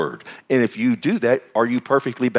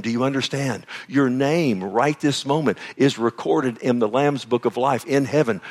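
normal talking voice

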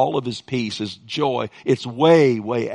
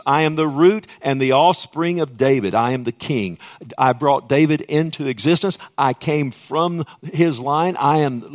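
loud, raised scripture proclamation; emphatic preaching; 3 semitones higher, 5 dB louder than usual. Real, not acted. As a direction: declare it forcefully as the words of Jesus. Proclaims Jesus' words in preaching.

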